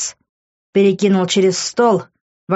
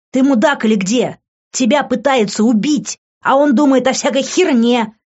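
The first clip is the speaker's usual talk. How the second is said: angry telling-off; 6 semitones higher than usual. Acted. Les berates Grant.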